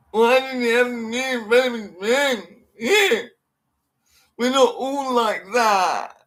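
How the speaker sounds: high mock sobbing whine; mock crying voice. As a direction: sob it out as mockery.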